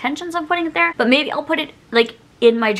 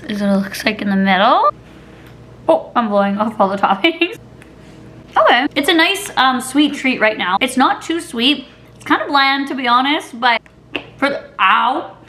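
talking between bites; mouth full, chewing. Real, not acted. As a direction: hand over mouth while chewing. Seen 0: Holds cup, spoon bites around 4.1s, talks while chewing after.

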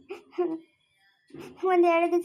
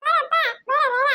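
much higher put-on voice calling someone over; high pleading call; 10 semitones higher, 4 dB louder, slower than usual. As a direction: call them over in a squeaky character voice.

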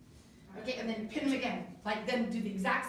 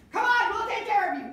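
loud, high call to the frightened friend; coaxing yell as you pull her along; yelling reassurance; 10 semitones higher, 10 dB louder than usual. Shown as a demonstration. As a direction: shout it out to comfort her. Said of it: "she's yelling about that".

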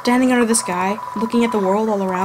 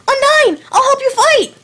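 put-on eager kid voice; 5 dB louder than usual. Voices eager game kid character.

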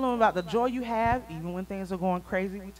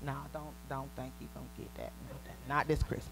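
ordinary talk, nothing marked

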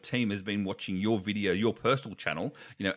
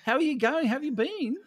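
bright greeting; 14 semitones higher, 3 dB louder than usual. Real, not acted.

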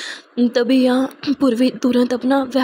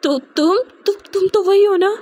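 shocked recognition; 6 semitones higher than usual. A narrator's reading.

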